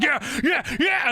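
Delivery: high, rowdy yeah yeah; brawling crowd. Acted. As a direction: cheer like a crowd picking a fight. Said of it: "They're like".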